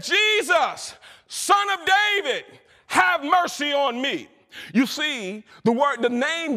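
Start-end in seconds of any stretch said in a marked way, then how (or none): 0.0-4.3 high, pleading call for mercy; blind man's cry. Retold or imitated. Blind Bartimaeus crying out to Jesus, high and pleading. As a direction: call out to Jesus over the crowd. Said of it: "Listen to the tone in this guy's voice when he cries".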